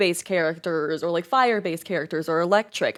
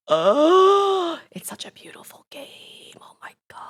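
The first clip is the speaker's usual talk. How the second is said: a gasp, then breathy hush; awed whisper; 9 semitones higher, 4 dB louder than usual. Real, not acted. Streamer gushing about a beloved game, in an awed whisper. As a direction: whisper it in awe.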